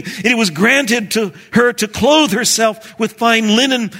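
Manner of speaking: loud, high proclamation of scripture; exultant scripture reading. A narrator's reading. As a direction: proclaim the verse at full force.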